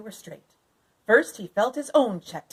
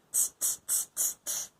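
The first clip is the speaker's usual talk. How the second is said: soft, breathy tutting; monkey tsk-tsk; 11 dB quieter than usual. A narrator's reading. Monkeys tutting back at the peddler, teasing. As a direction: tut back at him like a cheeky monkey. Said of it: "they said".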